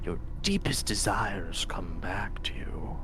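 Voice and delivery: soft, slow, unvoiced temptation; whispering tempter voice. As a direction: lure him in like a voice in his head.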